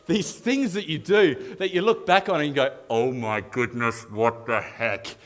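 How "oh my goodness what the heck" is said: low, slow, appalled aside; mock-horrified drawl; cringing disbelief. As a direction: say it slowly and low, dismayed at yourself. Cringing look back at childhood.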